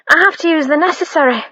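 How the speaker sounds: quick, high blurt. A narrator's reading. Maid blurting to her mistress, quick and high. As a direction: rush it out, embarrassed. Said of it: "finally blurted".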